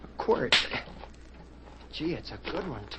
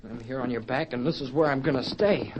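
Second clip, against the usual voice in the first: ordinary delivery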